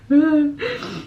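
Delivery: high shaky sigh through tears; a sigh to steady herself after crying; tearful sigh. Real, not acted. Exchange student calming herself after tears, sighing. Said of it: "Wow, I am emotional".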